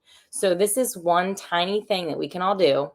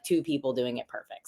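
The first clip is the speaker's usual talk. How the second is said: ordinary delivery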